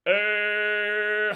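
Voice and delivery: long, loud L hum in 을; held nasal L. Shown as a demonstration. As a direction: hold 을 as an L, air through the nose. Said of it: "공기가 비치향으로, 비강으로 나가죠".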